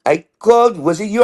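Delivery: put-on schoolteacher accusation; science teacher. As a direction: imitate a teacher confronting a pupil. She goes.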